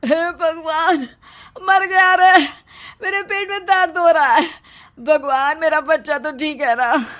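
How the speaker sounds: loud, high anguished wail; crying out in pain. Acted. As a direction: howl it like the pain is tearing through you. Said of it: "सिद्धांत रोने लगता है".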